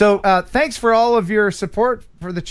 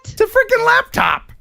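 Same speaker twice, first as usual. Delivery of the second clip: excited, very high-pitched exclamation; thrilled disbelief; 3 dB louder than usual. Real, not acted. Host reveals the caller's gifted laptop, thrilled. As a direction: let the voice leap up in amazement.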